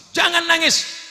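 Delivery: mock-rousing shout; acted stern order. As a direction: order the wife to stop crying. Saya kasih tahu istri.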